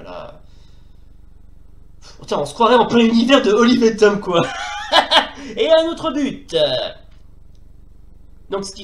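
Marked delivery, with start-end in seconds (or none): 2.2-4.4 fast, loud outburst; real shouted exasperation; exasperated shout. Real, not acted. Player shouts at game chaos. 4.9-6.6 slow words through a laugh; real amused laugh. Real, not acted. Laughs at another goal.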